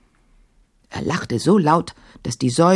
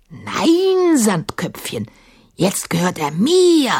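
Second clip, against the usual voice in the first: mocking djinn; 4 semitones higher, slower than usual. A narrator's reading. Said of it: "entschied Sahim".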